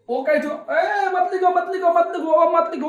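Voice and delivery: high, loud, rapid-fire "don't do it"; urgent mock warning. Teacher urgently stops students writing.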